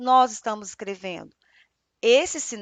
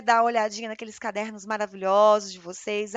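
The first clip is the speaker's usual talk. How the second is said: usual speaking voice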